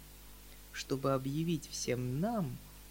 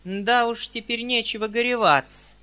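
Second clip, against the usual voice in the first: put-on high, loud, sniping old maid voice; high, louder prim spinster voice; spiteful spinster; 8 semitones higher, 10 dB louder than usual. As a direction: needle him as the prim spinster.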